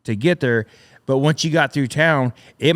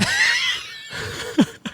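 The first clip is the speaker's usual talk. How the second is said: a sudden high whooping laugh at the memory; burst of high laughter; 4 dB quieter than usual. Real, not acted. Laughing hard while retelling the convoy mishap.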